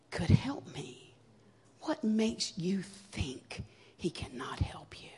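slow, whispered, breaking; tearful plea. Retold or imitated. Sobbing mother pleading with daughter, slow whisper. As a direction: plead softly through tears.

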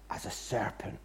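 hushed whisper on the serpent. Retold or imitated. Lecturer reveals the devil as serpent in a whisper. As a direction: drop to a breathy whisper.

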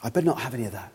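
hushed, low uh-oh to oneself; softly acted wary self-warning; alarmed realisation. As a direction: drop to a near whisper as it dawns. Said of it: "the alarm bell goes off".

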